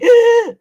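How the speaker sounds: very high, loud imitated gasp; loud mock gasp, way up high; kids' shocked gasp. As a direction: gasp like a roomful of startled kids. All the kids gasp at pop.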